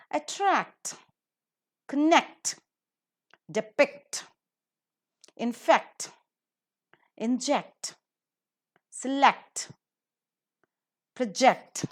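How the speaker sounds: Attract, connect, depict, infect, inject, select, project, each stressed on the final syllable; at-TRACT, con-NECT, de-PICT, in-FECT, in-JECT, se-LECT, pro-JECT, word by word; last syllable accented. Shown as a demonstration. Teacher models C T words from Attract to project, last syllable accented. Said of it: "the last syllable is accented".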